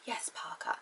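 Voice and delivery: very soft imitation of a refined lady; hushed, lightly put-on lady's voice; Lady Penelope. Shown as a demonstration. Lady answering her driver, soft character imitation. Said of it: "I can kind of do how Lady Penelope would speak".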